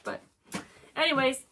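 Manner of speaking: ordinary delivery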